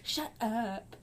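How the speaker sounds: softly voiced mock "shut up"; retold kid retort. Kid brushing off sarcastic praise, retold muted retort. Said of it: "And he'd be like".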